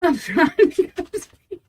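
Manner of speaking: high, squeaky words through real laughter; baffled, words swallowed by giggles; helpless laughing. Real, not acted. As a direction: struggle to talk through a laughing fit. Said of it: "I don't know why I'm laughing".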